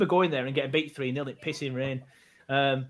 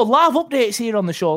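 announcer; 5 semitones higher, 8 dB louder than usual. Acted. Announcer hype for the viewers.

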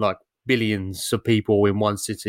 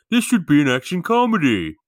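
louder, higher mock Stallone; Stallone impression; 6 semitones higher, 4 dB louder than usual. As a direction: toss it off like a star. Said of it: "and he said".

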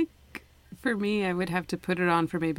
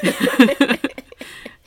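laughing; 8 semitones higher, 13 dB louder than usual. Real, not acted. Laughing at hearing herself.

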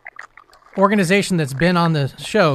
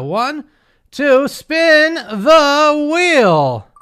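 slow, drawn-out, higher, louder wheel call; hyped giveaway announcement; 9 semitones higher, 5 dB louder, much slower than usual. Real, not acted. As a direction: stretch it out like a game show host. Giveaway wheel spin on show.

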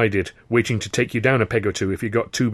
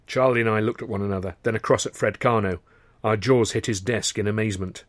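ordinary delivery

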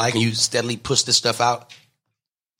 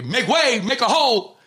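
security calling out; 12 semitones higher than usual. Retold or imitated. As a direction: clear the crowd like a bodyguard. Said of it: "and then I hear".

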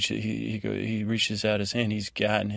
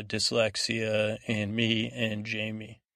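ordinary talk

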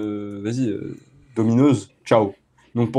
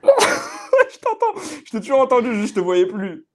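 after laughing hard; 8 semitones higher, slower than usual. Real, not acted. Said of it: "Je pleure de rire".

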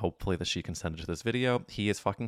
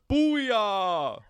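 booyah shout; 6 dB louder than usual. Retold or imitated. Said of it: "he goes".

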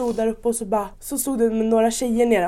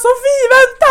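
high, loud call of "Sofie, vänta!"; desperate mock call to a friend; 14 semitones higher, 10 dB louder than usual. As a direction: shout after her as she slides off. Calling after friend sliding away.

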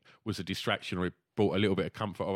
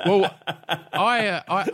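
laughing; 6 semitones higher, 10 dB louder, much slower than usual. Real, not acted. Laughing at own joke.

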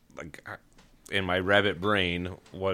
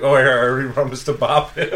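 laughing quote; 4 semitones higher, 9 dB louder than usual. Retold or imitated. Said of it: "but I'm like".

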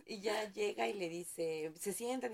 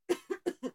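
coughing fit; 11 semitones higher, 6 dB louder than usual. Real, not acted. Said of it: "la tos no se me quita".

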